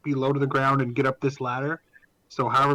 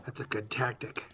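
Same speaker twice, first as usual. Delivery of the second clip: whispered approval; 2 semitones lower, 10 dB quieter than usual. Real, not acted. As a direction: breathe it out under the voice. Whispered aside.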